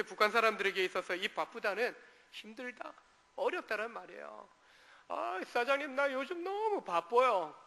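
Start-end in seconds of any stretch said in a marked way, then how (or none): 5.1-7.5 higher, slowed plea to the boss; a worn-out worker moaning to the boss; complaining worker. Retold or imitated. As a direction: whine to the boss that you're swamped. North Korean worker complaining to boss.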